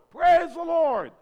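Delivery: slow, high, full-voiced shout; church-wide shout of praise. Shown as a demonstration. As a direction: belt it out like a cheering crowd. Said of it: "to shout".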